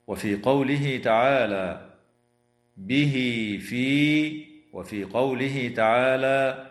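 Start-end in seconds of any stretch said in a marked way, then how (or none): 2.8-4.5 recited "به في" with drawn-out vowels; silah. Shown as a demonstration. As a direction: lengthen "به" to "bihii" before "في". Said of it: "فحكمها الصلة". (صلة هاء "به" بمد حركتين)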